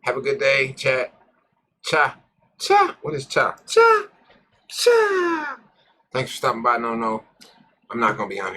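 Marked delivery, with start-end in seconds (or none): 1.8-5.6 high squealed cha; goofy falsetto cha; squeaky cha. Pretend. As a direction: squeak the word like a cartoon. Playful repeated squeal of cha.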